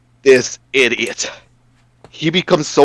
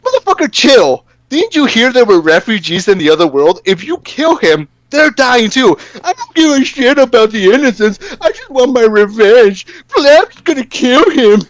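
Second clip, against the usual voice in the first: mocking Liu Kang voice; 7 semitones higher than usual. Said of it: "Liu Kang's like".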